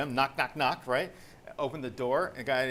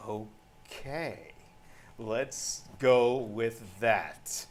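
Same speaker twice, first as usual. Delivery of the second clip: very slow, drawn-out words; reluctant agreement; much slower than usual. Retold or imitated. Buyer giving in after the phone went silent, reluctant agreement. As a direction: drag each word out after a stunned pause.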